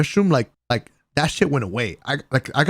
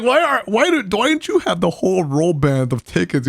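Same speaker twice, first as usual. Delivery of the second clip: laughing disbelief; 8 semitones higher, 4 dB louder than usual. Retold or imitated. Retold question to Peter, laughing.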